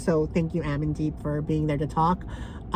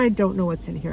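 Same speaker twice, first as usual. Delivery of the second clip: usual speaking voice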